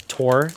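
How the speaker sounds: tour as one syllable, "tore"; alternative pronunciation of tour. Shown as a demonstration. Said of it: "Is that how you're supposed to say it?".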